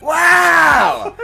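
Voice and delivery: ordinary delivery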